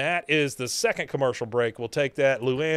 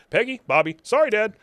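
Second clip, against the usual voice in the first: quick louder run of lines; rapid recap; 9 semitones higher, 5 dB louder, faster than usual. Shown as a demonstration. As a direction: rattle it off boom boom boom. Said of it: "So we get".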